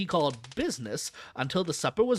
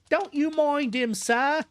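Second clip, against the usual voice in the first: higher, cheery woman's voice; cheerful wife breaking in, pitched up; 12 semitones higher, slower than usual. Acted. Keeper's wife reassuring the visitor, cheery and higher. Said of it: "broke in Missus Tom with a cheery laugh".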